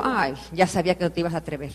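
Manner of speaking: low, soft, knowing jab; husband quietly daring his wife; teasing taunt. Retold or imitated. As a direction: needle her quietly so she rises to it. Husband taunting her to provoke her.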